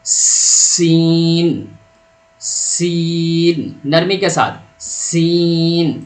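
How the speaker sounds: سین held long and soft, a gentle hiss; سین said slowly, soft thin s sound; soft drawn-out seen. Shown as a demonstration. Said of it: "اس کو پڑھیں گے کیسے".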